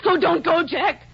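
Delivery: rising to a loud high cry of his name; desperate call. Acted. Wife calling after departing husband, desperate cry. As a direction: call him back before he leaves.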